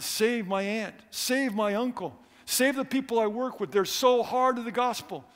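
rising fervent prayer; fervent preaching. Acted. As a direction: plead with God from the pulpit. Preaching and praying at full force.

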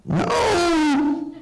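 put-on high, wailing child's no; high kid yelp; whiny kid no. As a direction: cry out like a hurt little kid. Kid shouting no in pain.

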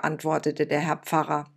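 ordinary delivery, nothing marked

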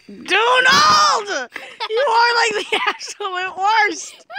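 high-pitched, drawn-out exasperated wail; exasperated shout. Real, not acted. Player scolds Donald for breaking the truce, exasperated wail.

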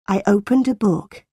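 plain reading voice

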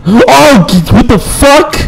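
high-pitched cry of disbelief; startled outburst. Real, not acted. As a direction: exclaim high, caught off guard. Startled after laughing.

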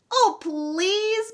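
put-on high, drawn-out child's plea; wheedling, pleading kids; begging. A narrator's reading. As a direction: beg in a high voice, stretching out "please". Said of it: "begged Meg and Sean".

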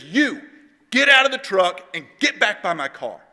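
forceful mock police command; stern cop order. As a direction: order someone out like an angry cop. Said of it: "this cop pinpoints Chris".

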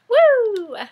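high, loud "woo" sound effect; playful whoop. Pretend. Showing off the lasso, playful high whoop. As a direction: let out a long swooping woo.

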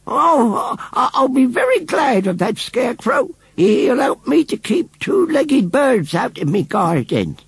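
put-on high, slow old woman's voice; drawn-out, pointed retort from an old woman; huffy old lady. A narrator's reading. Missus Kibbins pays up and snubs Tommy, huffy.

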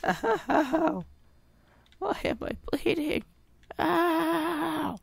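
high whiny wounded voice; hammed-up pain; mock pained whimper. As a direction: whimper like a hurt little creature. Character bleeding in game.

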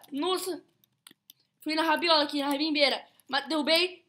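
ordinary delivery

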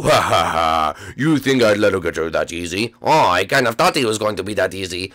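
very low evil laugh and taunt; deep villain gloat. Acted. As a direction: gloat like a game boss.

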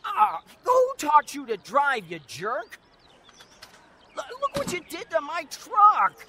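indignant yelling at a careless driver; angry outburst. Acted. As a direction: lay into the driver who hit your truck. Acted quarrel after truck is hit.

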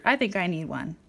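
ordinary delivery, nothing marked

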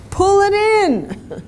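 high, drawn-out mock exasperation; exasperated retold command. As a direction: tell a hapless rider the obvious, slowly and high. Exasperated advice to horse rider.

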